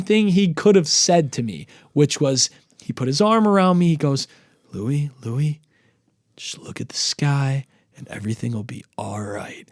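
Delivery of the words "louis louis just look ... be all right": low, whispery comforting voice; imitated cousin's soft, low calming murmur; hushed reassurance. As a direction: soothe a panicking friend, very softly. Cousin's arm round him, reassuring.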